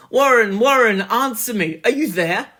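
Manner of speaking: loud, high frantic calls; frantic calling. A narrator's reading. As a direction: call desperately down the line. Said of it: "called, shouted and screamed".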